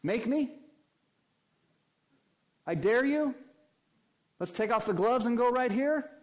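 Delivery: high, loud taunting dare; defiant demon. Acted. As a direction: taunt him to fight. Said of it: "what does the demon do".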